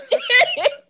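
very high delighted laugh; real laughter. Real, not acted. Reminiscing about wild parties, high delighted laughter. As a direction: squeal with laughter at the memory.

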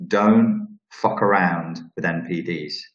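slow, spaced-out words, weight on Don't; slow emphatic warning. Real, not acted. Emphatic warning, then repeated quicker.

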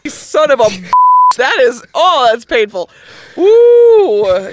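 loud real yelps of pain, cursing; pained yelling. Real, not acted. Hit by surprise, yelling at the pain in loud yelps. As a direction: cry out as it suddenly hurts.

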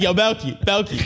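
high-pitched mock-nervous call for help. Nervous customer calls Belky over for backup, mock-nervous. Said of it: "I got nervous".

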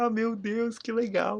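high, thrilled little squeal; delighted gush. Retold or imitated. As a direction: gush like you just got a surprise. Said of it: "a gente fica".

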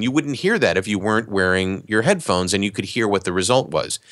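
ordinary delivery

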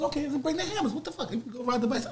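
high, dismissive, half-laughing retort; incredulous shrug. Retold or imitated. Telling his friends he doesn't care about the hammers, a high laughing shrug. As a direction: brush it off as if it's nothing. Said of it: "I said".

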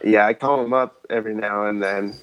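ordinary talk

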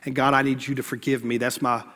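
low, soft, penitent prayer; prayer of confession. Acted. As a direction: confess humbly to God, letting the voice sink.

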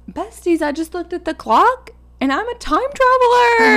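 loud, high excited yell; screaming. Retold or imitated. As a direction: scream it like a wild fan. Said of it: "He was screaming it".

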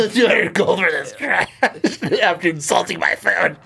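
laughing scornful shout. Acted. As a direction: scoff at the price. Customer scoffs at the price.

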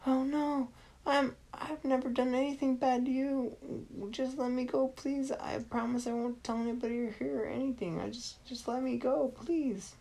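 put-on boy begging the villain in a high voice; pleading little boy. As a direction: beg to be let go. Boy begs to be released.

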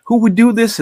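mock crying child, high pitched; crying kid. A boy telling his dad about the broken doll, in a high mock wail. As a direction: whine it high like a heartbroken kid. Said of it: "And I'm like".

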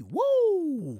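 high, loud wrestler woo; Ric Flair woo. Retold or imitated. Imitating Ric Flair coming down the aisle, high and loud.

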